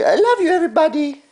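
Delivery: put-on high, fast baby voice; voicing the baby. Father voices baby Grace to viewers, high fast. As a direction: speak for the baby, high and quick.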